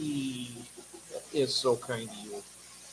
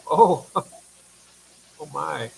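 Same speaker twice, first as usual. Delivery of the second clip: laughing; 8 dB louder, much slower than usual. Real, not acted. Laughing at a chat comment.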